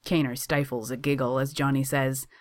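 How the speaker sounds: ordinary delivery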